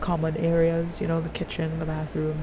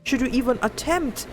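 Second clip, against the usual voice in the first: higher, sharper alarmed rebuke; pitched-up, hammed-up outrage; stern scolding master; 6 semitones higher than usual. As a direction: scold her like a furious elder. Angry master scolds girl.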